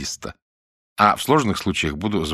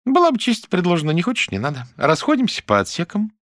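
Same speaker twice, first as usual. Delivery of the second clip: mock offended; 12 semitones higher, 4 dB louder than usual. Mira feigns taking offense.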